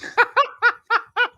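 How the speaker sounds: high, helpless laugh; burst of laughter. Real, not acted. Co-host cracks up after the coach bit, high laughing. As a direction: crack up at your own joke.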